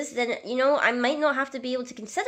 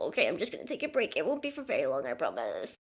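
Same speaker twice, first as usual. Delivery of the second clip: quiet; 4 semitones lower, 9 dB quieter than usual. Acted. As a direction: mumble it off as you drift away. Muttering off as she goes to play.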